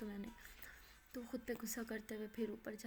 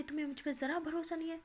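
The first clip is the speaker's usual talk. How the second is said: higher, louder furious outburst; angry shout; 5 semitones higher, 5 dB louder than usual. Acted. Betrayed girl yells at her doubting friend, furious. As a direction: yell the accusation back at her. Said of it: "माया गुस्से से चीखती है".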